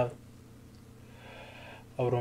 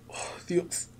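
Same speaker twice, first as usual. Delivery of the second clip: despairing whisper; whispered plea; 4 semitones higher, 4 dB quieter than usual. Acted. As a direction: breathe it as a broken prayer.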